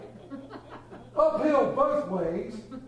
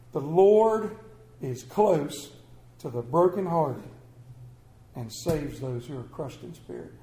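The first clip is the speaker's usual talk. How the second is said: scripture reading; 6 semitones lower than usual. Acted. Preacher reading a psalm to his congregation, slow and reverent. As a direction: read the verse out like it matters, then let it fade. Reads scripture verse aloud.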